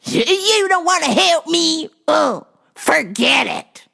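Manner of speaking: high, loud mock outburst from a huffy lady; hammed-up high customer voice; shrill angry customer. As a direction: squeal it like an offended customer.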